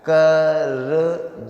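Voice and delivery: कर जा split in two, very slow; slow motion. Shown as a demonstration. Teacher to students, breaking the word apart slowly. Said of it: "बहुत slow motion में धीरे-धीरे बोलेंगे".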